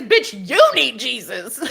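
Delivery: slow, laughing mock retort; laughing comeback. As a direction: toss it back with a giggle. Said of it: "the first thing I say is".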